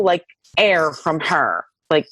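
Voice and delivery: ordinary talk, nothing marked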